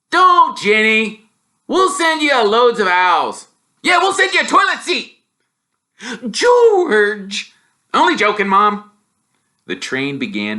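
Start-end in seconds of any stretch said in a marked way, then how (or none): 0.1-5.2 a brother teasing his crying sister; higher, louder cheeky twin; teasing boy twin. Acted. 6.3-7.5 loud scandalized cry; a mum scolding a rude joke; mother's outraged cry of the name; shocked mother. Acted. 7.9-8.9 higher, loud, breezy; a kid brushing off the scolding; lightly voiced twin backing down; cheeky boy twin.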